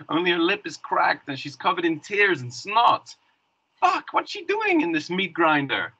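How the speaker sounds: high-pitched, agitated disbelief; acted alarm. Man finds his bridesmaid beaten in the crackdown, alarmed. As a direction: voice jumps up in dismay.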